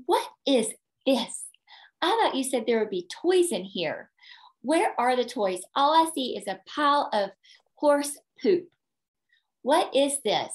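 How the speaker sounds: put-on grumbling little boy stuck with a pile of manure; whiny complaining boy. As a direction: whine it like a sulky four-year-old. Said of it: "And he's complaining".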